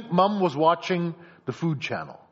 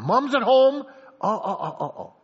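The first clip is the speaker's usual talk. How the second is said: put-on louder, higher kid's outburst; playful raised-voice exclamation; mock alarmed cry; 6 semitones higher, 7 dB louder than usual. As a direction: call it out high and loud. Child calling out while watching.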